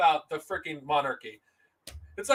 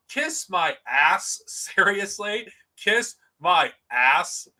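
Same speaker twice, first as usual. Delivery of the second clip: slow taunt; 3 semitones lower, much slower than usual. Real, not acted.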